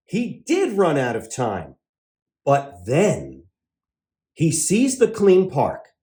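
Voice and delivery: ordinary delivery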